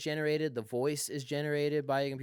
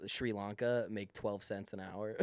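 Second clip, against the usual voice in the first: ordinary talk, nothing marked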